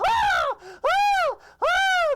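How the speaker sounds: piercing, very high loud shriek; cartoon scream. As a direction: scream at full pitch like a terrified cartoon. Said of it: "the screaming is still going on".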